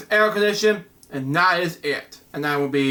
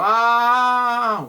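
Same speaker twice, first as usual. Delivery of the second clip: hammed-up scream; 4 semitones higher than usual. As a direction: cry out, alone and locked out. All alone, scream again.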